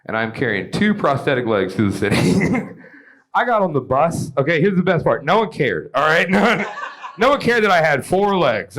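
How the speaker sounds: ordinary talk, nothing marked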